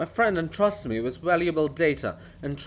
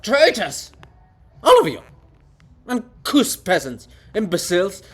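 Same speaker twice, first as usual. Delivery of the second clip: furious shout; 3 semitones higher, 7 dB louder than usual. Acted. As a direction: hurl the insults at them all. Bulgakov raging at everyone.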